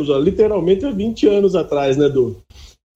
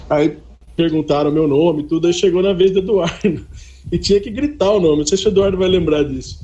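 ordinary talk

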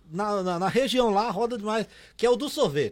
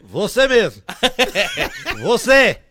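loudspeaker call; 5 dB louder, slower than usual. Retold or imitated. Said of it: "do nada assim, ó".

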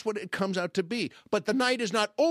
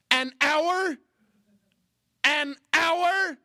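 high, loud outraged disbelief; exaggerated indignant yelp; incredulous outburst; 10 semitones higher, 6 dB louder, much slower than usual. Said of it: "Well, I didn't say it like that".

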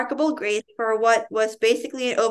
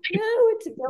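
surprised gasp; 8 semitones higher, 3 dB quieter, much faster than usual. Real, not acted. Gasp protesting the ending.

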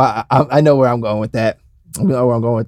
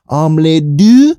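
very slow, higher mock French; exaggerated French accent; 3 semitones higher, 4 dB louder, much slower than usual. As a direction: stretch it out like a hammy Frenchman. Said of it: "like a French guy".

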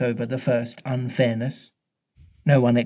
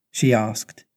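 ordinary delivery, nothing marked

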